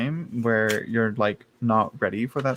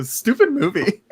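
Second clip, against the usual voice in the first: high, laughing mock scold at a movie; 10 semitones higher, 4 dB louder than usual. Reader mimicking her tearful self, laughing mock scold. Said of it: "I just go".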